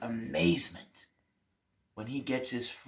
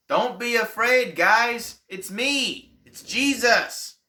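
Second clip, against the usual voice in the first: high, loud call across the water, slowing at the end; 10 semitones higher, 8 dB louder, slower than usual. Acted. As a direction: shout reassurance over a storm. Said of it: "they hear a voice call out to them".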